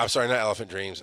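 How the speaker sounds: ordinary delivery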